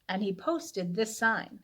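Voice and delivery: plain reading voice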